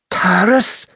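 high-pitched gasp of his name. A narrator's reading. Wounded Merinda finds Taras alive, gasping his name. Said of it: "she gasped".

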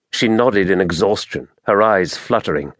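usual speaking voice

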